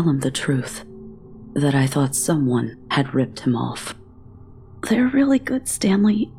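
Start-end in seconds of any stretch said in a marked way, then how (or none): 4.9-6.3 high, forced cheer, a bit too bright to be true; feigned enthusiasm. A narrator's reading. Girl forcing praise for the shopkeeper's candy, fake excited. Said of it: "feigning enthusiasm".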